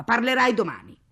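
loud, high dismissive order; angry. Acted. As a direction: silence a husband scornfully. Mother cuts husband off. (zittisce con rabbia)

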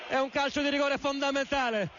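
fast radio sports commentary; live radio commentary. Real, not acted. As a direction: call the match live. Live play-by-play.

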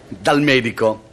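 normal talking voice

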